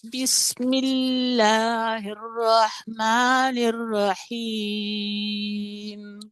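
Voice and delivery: measured tajwid recitation, very slow; slow Quran recitation. Shown as a demonstration. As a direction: chant it slowly, drawing out each syllable. Tajwid teacher recites basmala.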